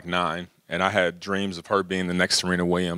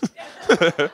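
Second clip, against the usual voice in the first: a bright, high burst of laughter; high self-amused chuckle; 9 semitones higher than usual. Real, not acted. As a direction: laugh at your own joke. Laughing at own joke.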